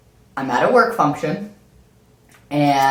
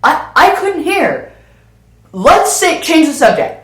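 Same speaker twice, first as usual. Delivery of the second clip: pleading; 7 semitones higher, 3 dB louder than usual. Retold or imitated. Pleading to change subject.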